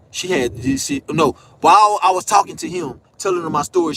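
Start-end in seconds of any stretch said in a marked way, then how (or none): none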